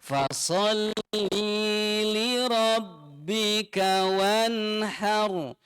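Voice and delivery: "li" stretched long in a slow chant; lengthened li. Shown as a demonstration. Teacher imitating the mistake of lengthening li. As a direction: drag out the "li". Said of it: "li-nya dipanjangkan".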